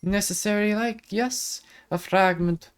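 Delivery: high lilting Irish fairy voice; Irish accent. Acted. Fairy character voice continues.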